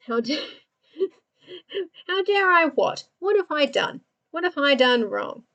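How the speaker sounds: high, laughing mock indignation; mock offended. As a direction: act wrongly accused, half-laughing. Mock offended reply to chat.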